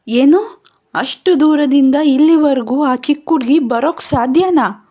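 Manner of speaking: can't believe it; shocked disbelief. Acted. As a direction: Pankaj reacting in disbelief to the news. Pankaj shocked at news.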